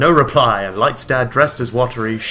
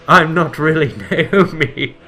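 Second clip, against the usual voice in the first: slow, louder reveal, breaking into a laugh; mock dramatic reveal; 4 semitones higher, 6 dB louder, much slower than usual. Reading the fanfic twist aloud, laughing. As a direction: play the big twist, laughing through it.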